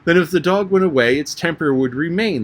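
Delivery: spring the winning point triumphantly. A narrator's reading. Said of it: "exclaimed triumphantly".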